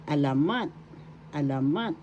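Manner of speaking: "alamat" with its last syllable accented, a-la-MAT; stress on third syllable. Shown as a demonstration. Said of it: "The accent is on the third syllable".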